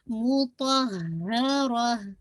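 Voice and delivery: मुतहहरा very slow, the h sounded twice; doubled haa. Shown as a demonstration. Teacher shows the doubled letter, slow recitation. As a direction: stretch मुतहहरा, doubling the h clearly. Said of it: "आप इसको twice पढ़ रही हैं".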